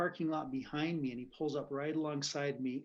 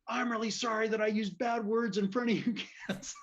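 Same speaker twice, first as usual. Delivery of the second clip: high, raised mock yell; yelled apology; 7 semitones higher, 4 dB louder than usual. Big man apologizing to scared kids, still yelling. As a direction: apologize at a near shout, imitating the man. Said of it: "still yelling at them".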